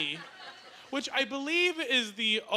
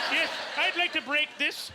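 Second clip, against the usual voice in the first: bank customer; 5 semitones higher than usual. Pretend. A customer at the bank cheerfully asking to break a giant coin. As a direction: ask the teller brightly. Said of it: "Be like".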